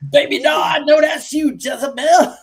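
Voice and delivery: high, loud mock promo yell; imitating the caller's yell. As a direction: squeal it like the caller, laughing. Host imitating the clip.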